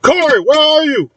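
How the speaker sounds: high-pitched searching shout; high, sustained call to someone unseen. Acted. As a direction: holler high across a burning building. Calling out to find Corey.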